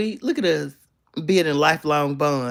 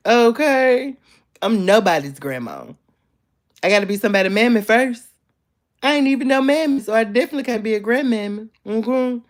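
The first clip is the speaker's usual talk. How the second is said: indignant sass; 6 semitones higher, 5 dB louder than usual. Real, not acted. Denying being a viewer's grandma.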